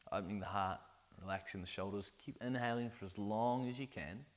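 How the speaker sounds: soft guided breathwork voice; guided meditation. Acted. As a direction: lead the breathing gently.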